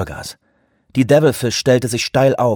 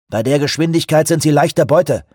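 calling out; 6 semitones higher, 5 dB louder than usual. A narrator's reading. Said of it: "rief Grey Wolf".

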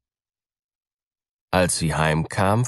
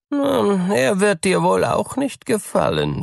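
high, coy cat voice; sly cat; 14 semitones higher, 3 dB louder than usual. A narrator's reading. Cat to mouse, high and coy. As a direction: tease the mouse before the answer. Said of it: "sagte die Katze".

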